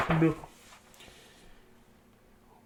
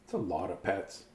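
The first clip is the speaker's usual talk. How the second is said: quiet aside; 9 semitones lower, 14 dB quieter than usual. Real, not acted. Aside, muttered low.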